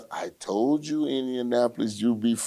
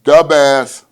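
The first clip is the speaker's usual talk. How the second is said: loud Chicago "Da Bears" call, "the Bears" as "da Bears"; Chicago accent; 6 semitones higher, 16 dB louder than usual. Retold or imitated. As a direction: bellow "the Bears" like a Chicago superfan. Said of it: "That's how they say it in Chicago".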